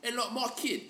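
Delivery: a hint of Cockney, listing wishes for her boy; Cockney mum. Cockney mum telling the Queen about her future son, lightly voiced. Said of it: "your mum's totally, like, Cockney".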